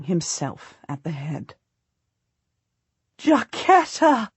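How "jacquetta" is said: high, slow, delighted call of a name; joyful cry. A narrator's reading. Queen spotting her friend by the road, joyful cry. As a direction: cry the name out with real joy. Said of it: "she cries out with genuine joy".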